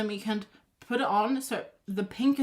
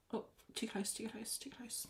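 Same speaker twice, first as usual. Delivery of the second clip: soft alarmed murmur; 14 dB quieter than usual. Real, not acted. Reacting softly to a near miss.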